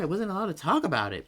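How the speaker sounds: ordinary delivery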